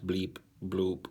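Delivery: even bleep bloop syllables; robot voice. Pretend. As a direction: chop it evenly like a machine. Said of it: "I am a robot".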